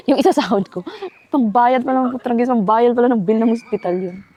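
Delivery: half-laugh, half-groan, dragging it out; rueful laughing complaint. Retold or imitated. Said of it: "Sabi ko ganun".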